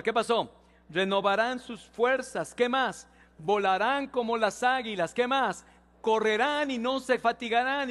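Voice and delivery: loud, slow, rising congregational reading; preaching at full force. Acted. Pastor leading the church through Isaiah, preaching loudly. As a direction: call out the verse to the church.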